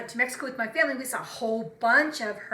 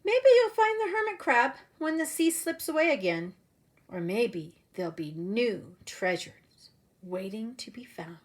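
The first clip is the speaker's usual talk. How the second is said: lively storybook read-aloud voice; animated picture-book narration; reading to children; 3 dB louder than usual. Acted. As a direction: read it like story time for little kids. Teacher reads picture book aloud.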